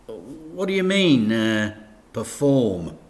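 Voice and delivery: mild nervous stammer over the word; panicky. A narrator's reading. Dad, put on the spot by the vicar, mildly panicky. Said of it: "a note of panic in his voice".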